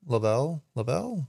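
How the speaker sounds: "Lovell" stressed on the second syllable; testing "Lovell" as luh-VELL; trying another pronunciation. Shown as a demonstration. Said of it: "I'm so bad with names".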